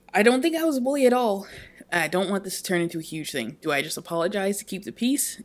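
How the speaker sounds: ordinary delivery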